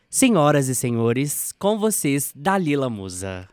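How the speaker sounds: slow, loud showman intro; grand entrance announcement. Acted. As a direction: announce her like a star on stage. Host announces guest's entrance. (anúncio de entrada triunfal)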